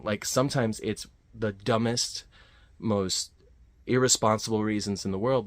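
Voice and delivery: normal talking voice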